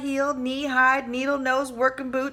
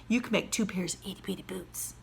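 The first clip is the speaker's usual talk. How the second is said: softer, unvoiced whisper; 3 semitones lower, 9 dB quieter than usual. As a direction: share the plan quietly. Wife whispering plan.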